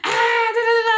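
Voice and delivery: very high, loud mock cackle; imitated cracking up. Friend's reaction to hearing of the panic attack, imitated cracking up. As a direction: mimic someone bursting out laughing. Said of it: "she start cracking up like".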